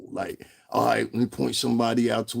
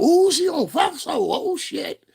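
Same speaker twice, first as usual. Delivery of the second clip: much higher, squealing fan voice, louder; mock fan gushing; 3 dB louder, slower than usual. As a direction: gush like an impressed fan. Mocking fans impressed by Fox.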